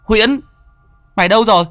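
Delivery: high, loud frantic calling across the river. A narrator's reading. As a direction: shout for the missing friend, panicked. Children calling missing friend. (gọi to, hoảng hốt, giọng cao)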